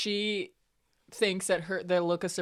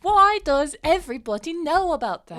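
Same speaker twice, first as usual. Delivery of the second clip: high, loud acted indignation; exasperated outburst; 8 semitones higher, 6 dB louder than usual. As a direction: burst out as if thumping the counter. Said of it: "kinda bangs her fist on the counter".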